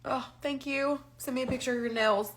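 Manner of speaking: normal talking voice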